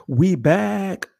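loud high hype call; hype shout. Acted. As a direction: announce it with energy. Hype intro.

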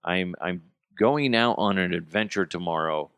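normal talking voice

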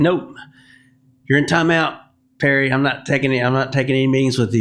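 half-joking mock sternness; mock scolding. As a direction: playfully put him in timeout. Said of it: "I was kinda messing with him".

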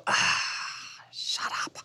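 whispered "shut up" to herself; muttered aside. Retold or imitated. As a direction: breathe it out under your breath, dismissively. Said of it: "bueno, digo".

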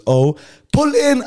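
put-on a playful girl drawing him in; slow, high, cooing girl's voice; girlish coo.

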